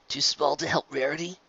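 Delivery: soft, taunting inner voice; nagging inner voice. A narrator's reading. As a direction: needle him like a doubt. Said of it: "little voice nagged".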